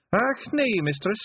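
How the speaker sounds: very high Scottish pixie voice; a proud wee Feegle. A narrator's reading. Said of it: "Not as big ... wrinkled his face".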